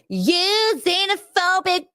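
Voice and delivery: high, slow mocking accusation; mocking accuser. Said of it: "and they're like".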